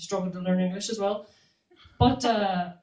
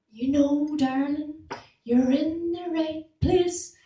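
slow, higher, syrupy auntie talking down; condescending auntie; 6 semitones higher, much slower than usual. Retold or imitated. Auntie to patient on a drip, slow condescending coo. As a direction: coo at the patient like a doting granny. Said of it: "You get this really condescending".

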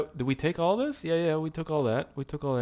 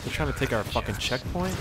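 ordinary talk

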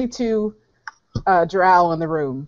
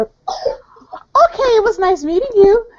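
very high, louder sign-off; high-pitched farewell; 4 dB louder, slower than usual. Acted. Prisoner cutting short an introduction, high-pitched farewell. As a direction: drag out a high okay, then say goodbye. Brushing off a new acquaintance.

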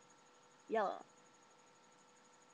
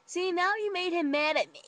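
higher, louder accusing whine; whiny complaint; 8 semitones higher, 6 dB louder than usual. Retold or imitated. Rachel blaming her friend, higher whiny complaint. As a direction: blame the friend.

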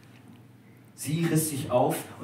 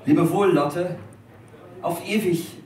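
much louder, higher farewell call; anguished farewell cry; 6 semitones higher, 8 dB louder than usual. A narrator's reading. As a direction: tear yourself away and cry goodbye. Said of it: "riss er sich weg und rief".

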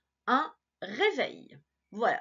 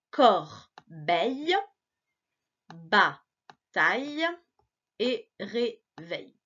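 Corbeille, Bataille and réveil each drawn out slowly; slow, clear reading of words; slow reading aloud; 2 semitones lower, much slower than usual. Shown as a demonstration. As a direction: read each word slowly for a child to follow. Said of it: "essaie de lire avec moi".

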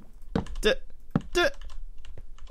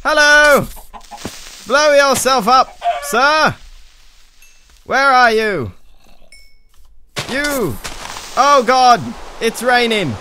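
high, loud calls at an enemy; shouting; 11 semitones higher, 9 dB louder, slower than usual. Real, not acted. Player shouts at a creeper to blow up, high yelling. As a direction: yell out in high-pitched alarm.

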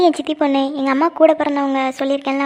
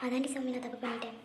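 hushed, close inner thought; 19 dB quieter than usual. Acted. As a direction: think it silently to yourself. Inner thoughts after friend's question.

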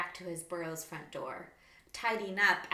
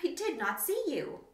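squeaky; 7 semitones higher, 4 dB louder than usual. Acted. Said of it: "squeaked the wallaby".

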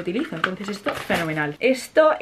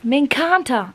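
admiring; 5 semitones higher, much slower than usual. Real, not acted. Admiring an eyeshadow.

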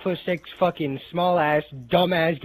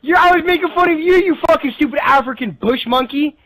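high, loud tirade; angry shouting; 9 semitones higher, 8 dB louder than usual. Real, not acted. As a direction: hurl the insult at full volume. Heated quarrel over being mocked.

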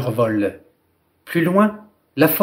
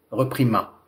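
plain reading voice

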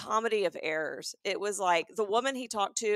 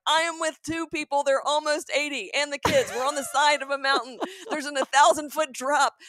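urgent; 6 semitones higher, 5 dB louder than usual. Retold or imitated. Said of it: "trying to make it sound like there's an urgency".

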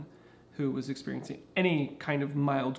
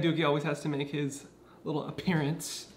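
usual speaking voice

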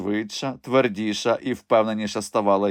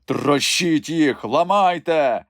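slow, furious, louder command; raging order; 5 semitones higher, 6 dB louder, much slower than usual. A narrator's reading. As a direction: rage at the soldiers. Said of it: "шаленів єпископ". (шалений наказ єпископа)

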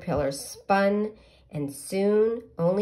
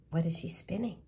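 whisper; 7 dB quieter, faster than usual. Real, not acted. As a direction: whisper it like a secret. Reader asks children.

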